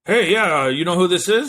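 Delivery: high, loud phone-caller impression; Peter Parker's boss. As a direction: put on a loud, pushy boss voice. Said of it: "he had, like, the voice of".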